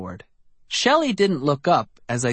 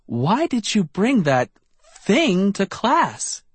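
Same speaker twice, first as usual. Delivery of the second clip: exasperated teacher; 7 semitones higher than usual. A narrator's reading. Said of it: "Miz McVee cried".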